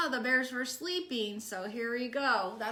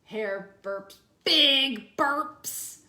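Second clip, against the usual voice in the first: usual speaking voice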